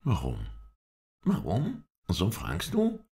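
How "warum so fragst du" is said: high-pitched, astonished echo of the question. A narrator's reading. Halef echoes his master's question, astonished. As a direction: throw it back in high disbelief. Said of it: "sagte er ganz erstaunt".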